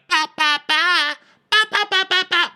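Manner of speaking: very high, monotone nonsense syllables; baby-fight babble. Shown as a demonstration. Mocking a rival like a fighting baby, high babble. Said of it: "You cannot argue with the baby".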